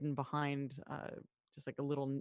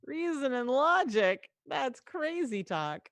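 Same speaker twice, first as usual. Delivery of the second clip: mock disbelief; 6 semitones higher, 5 dB louder, slower than usual. Real, not acted. GM mocks the player's plan.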